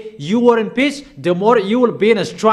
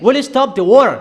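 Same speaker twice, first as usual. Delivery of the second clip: pleading question; 5 semitones higher, 5 dB louder, faster than usual. Acted. Imagined plea to stop war.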